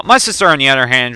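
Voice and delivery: normal talking voice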